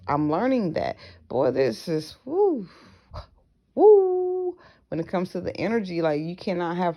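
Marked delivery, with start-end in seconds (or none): 2.3-4.6 excited whoo. Real, not acted.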